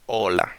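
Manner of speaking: hola said as "ola", H left out; silent H in hola. Shown as a demonstration. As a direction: open hola on the vowel O. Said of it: "because the H".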